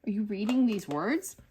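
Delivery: rising, amazed whoa whoa whoa; startled disbelief. Retold or imitated. Mother stunned as her toddler reads flashcards, startled disbelief. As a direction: catch yourself in shock at the child. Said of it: "it kinda scared me a little".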